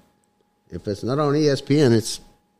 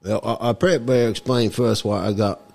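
comic mock-Cockney accent; put-on Cockney accent; 2 semitones lower, 3 dB quieter than usual. Guest explains his voice to the host, put-on Cockney accent. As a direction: talk in a hammed-up Cockney accent. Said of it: "something wrong with my voice".

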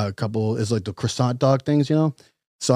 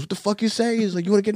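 retold yelling threat; 8 semitones higher, faster than usual. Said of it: "he starts screaming at me".

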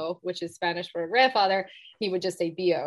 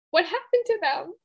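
getting emotional; 9 semitones higher, 3 dB louder than usual. Real, not acted. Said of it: "Sorry, I'm getting emotional".

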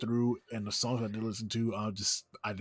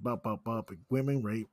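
usual speaking voice

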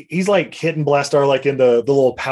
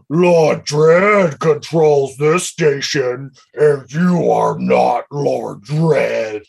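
very slow, heavy menacing robot voice; villain robot confronting the impostor, slow and menacing; Blastar voice; much slower than usual. Acted. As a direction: loom over them and drag every word. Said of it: "I gotta do my Blastar voice here".

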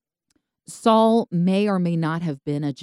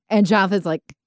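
ordinary talk, nothing marked

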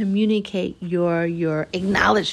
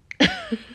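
sudden high laugh; quick high real laugh; high burst of laughter. Real, not acted. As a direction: laugh at your own slip. Laughing at own curse.

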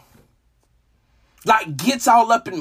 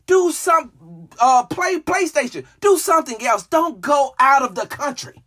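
higher-pitched, emphatic imitation of a coach's instructions; lightly voiced coach; 7 semitones higher than usual. Coach telling players how to spend bye week, lightly imitated and emphatic. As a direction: spell it out as the exasperated coach would. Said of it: "What he meant was".

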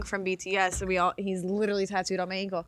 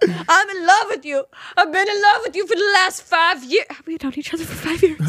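nervous trembling love confession; 9 semitones higher, 8 dB louder than usual. Pretend. Mock love confession to friend.

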